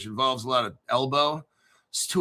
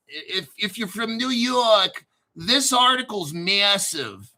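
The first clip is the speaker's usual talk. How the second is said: slow, higher-pitched put-on New Jersey accent; 4 semitones higher than usual. Addressing New Yorkers about a massive article, in New Jersey accent. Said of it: "is that my New Jersey accent".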